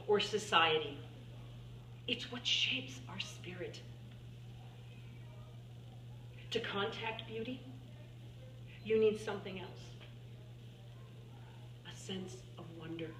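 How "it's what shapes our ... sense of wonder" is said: hushed and tender. Acted. Hushed turn in the testimony.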